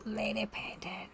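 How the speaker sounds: very soft, voiceless murmur while typing; whispering to self. Real, not acted. Streamer typing a name to herself, whispering. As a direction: whisper the words as you type them.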